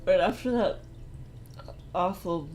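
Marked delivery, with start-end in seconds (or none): none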